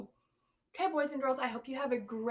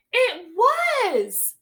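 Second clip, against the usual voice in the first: louder and higher, delighted; excited reveal; 8 semitones higher, 14 dB louder than usual. A narrator's reading. Teacher revealing the boy's worry to the class, excited. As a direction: announce it like a discovery.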